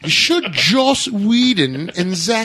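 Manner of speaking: high, slow mock-posh British; hammed-up, drawn-out British toff accent; horrible British accent. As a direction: read it out as a badly faked English toff. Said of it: "I apologize for that horrible British accent".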